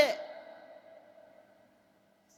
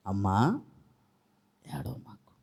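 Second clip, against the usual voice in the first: much lower, very soft whisper as Jesus; gentle Jesus; 10 semitones lower, 11 dB quieter than usual. Acted. Jesus whispering softly to the weeping woman, low and tender. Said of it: "ఏమంటాడు? చెప్పండి?".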